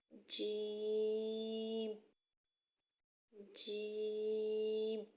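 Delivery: جیم stretched out very slowly; lengthened jeem. Shown as a demonstration. Teacher showing how long جیم is held. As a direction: draw the vowel of جیم out long. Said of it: "تھوڑا لمبا کر کے پڑھا جائے گا".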